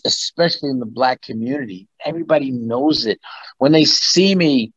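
ordinary talk

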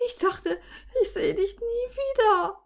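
high, tearful whimper; high-pitched, acted near-sob. A narrator's reading. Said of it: "wimmerte ich".

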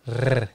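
trilled rrr sound; rolled r trill. Shown as a demonstration. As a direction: roll the r slowly. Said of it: "you take your tongue".

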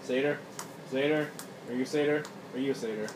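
ordinary talk, nothing marked

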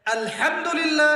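আলহামদুলিল্লাহ drawn out slowly, each syllable clear; slow, exact Arabic recitation of আলহামদুলিল্লাহ; careful recitation. Shown as a demonstration. Preacher shows the correct way to say আলহামদুলিল্লাহ, slow and careful. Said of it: "সঠিক করে উচ্চারণ করতে পারছেন".

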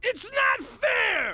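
put-on high, slow, drawn-out childish wail; shouting it's not fair like a sulky kid; whiny kid protest. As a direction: stamp your foot like a grounded teenager. Grounded husband protests like child.

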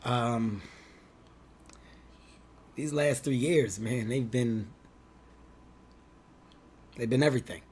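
soft, grave heartfelt confession; breaking bad news. Shown as a demonstration. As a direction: speak quietly and heavily from the heart. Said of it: "Watch, watch".

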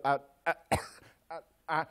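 acting out words stuck in the throat; choked up. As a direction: stammer and cough, imitating failed speech. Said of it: "It choked up".

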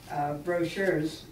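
ordinary talk

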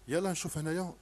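usual speaking voice

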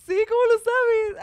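loud high laughing reply; loud squealing laugh; laughing cry. Real, not acted. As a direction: blurt it out through laughter. Laughing shared joke.

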